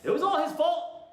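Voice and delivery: high, loud mock protest; mock blame. Blaming her husband for the drug mix, high mock protest. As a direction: pin it on him, all innocence.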